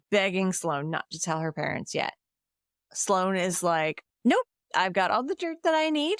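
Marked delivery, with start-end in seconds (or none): 4.2-6.2 high-pitched, sing-song taunt; playfully smug, pitched way up; mock gloating refusal. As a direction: imitate a sister relishing her leverage. Retelling a gloating sister's refusal.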